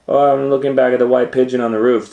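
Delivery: put-on fast, loud, high boyish voice; quick boy's excuse. A narrator's reading. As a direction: rattle off the excuse. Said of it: "said Hansel".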